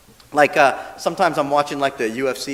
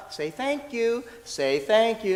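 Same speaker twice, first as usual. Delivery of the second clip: put-on parent prompting child; 6 semitones higher, 5 dB quieter, slower than usual. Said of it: "trying to teach them".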